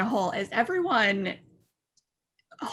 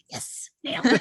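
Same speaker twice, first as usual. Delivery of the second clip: hushed self-congratulation; whispered inner voice. Retold or imitated. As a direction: whisper it to yourself, pleased. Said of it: "and I'm like".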